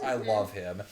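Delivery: ordinary delivery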